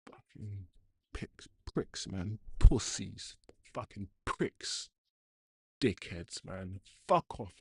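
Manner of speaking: bitter angry mutter; muttered cursing. Real, not acted. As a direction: grumble the insults to yourself in disgust. Team concedes, bitter cursing to himself.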